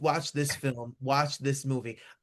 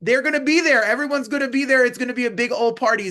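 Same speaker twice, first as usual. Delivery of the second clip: loud, high party hype; excited; 8 semitones higher, 7 dB louder than usual. Real, not acted. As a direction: shout it like a party host. Hyping up the party.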